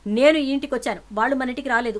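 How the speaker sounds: loud defiant shout at aunt; shouting back. Acted. Niece shouts defiantly at her aunt, loud.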